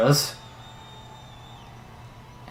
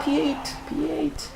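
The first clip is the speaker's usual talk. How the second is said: very high, thin cry falling softer; dismayed squeal; 4 dB quieter than usual. Real, not acted. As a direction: squeal it in despair as the scream tails off. Dismay at dropping to eighth.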